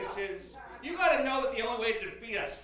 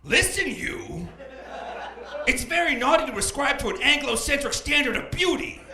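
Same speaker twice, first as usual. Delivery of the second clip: raised, loud mock reprimand; hammed-up indignant telling-off; scolding Santa Claus; 6 semitones higher, 5 dB louder than usual. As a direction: lecture a child as an offended Santa. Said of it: "you're gonna be Santa Claus".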